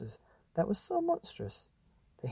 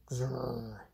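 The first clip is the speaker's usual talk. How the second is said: soft rumbling grrr; monster growl; 5 dB quieter than usual. Acted. Monster growling while waiting, soft grrr. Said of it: "they said".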